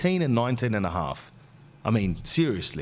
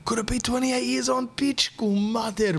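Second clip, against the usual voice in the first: mock outburst; 12 semitones higher than usual. Comic rant about age.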